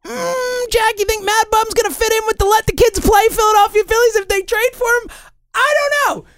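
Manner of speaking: very high, put-on squeaky questioner; silly high-pitched voice. Host putting a mock question to Jack, silly and high-pitched. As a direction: ask it in a comically high voice.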